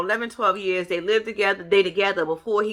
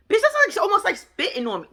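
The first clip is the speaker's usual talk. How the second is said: outraged squeal; 9 semitones higher than usual. Real, not acted. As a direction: squeal in disbelief. Outrage at drink flick.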